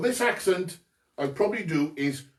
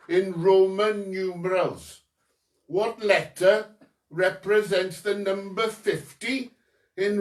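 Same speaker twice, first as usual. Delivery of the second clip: put-on deliberate Maesteg Welsh accent; 2 semitones higher, 3 dB louder than usual. Quizmaster reading question three, in a Maesteg accent. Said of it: "That was an accent coming from my stag".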